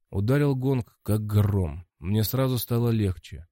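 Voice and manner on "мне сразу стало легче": ordinary delivery, nothing marked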